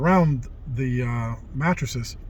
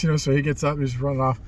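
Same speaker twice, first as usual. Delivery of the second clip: ordinary talk, nothing marked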